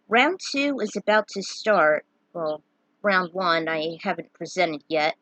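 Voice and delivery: usual speaking voice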